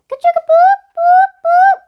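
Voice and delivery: very high, loud mechanical bell chant; bell sound. A narrator's reading. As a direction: ring it out like an alarm.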